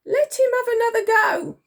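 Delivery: high, loud plea; pleading. A narrator's reading. Dotty to the Admiral for Titch, pleading. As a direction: beg loudly on his behalf. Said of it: "pleaded Dotty".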